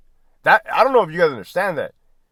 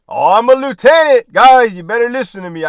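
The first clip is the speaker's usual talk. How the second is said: higher, louder self-important boss voice; hammed-up bossy superior ordering his men; mock pompous lieutenant; 8 semitones higher, 10 dB louder than usual. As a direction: puff up like a rank-proud officer lording it over his guys. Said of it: "did you ever hear me say".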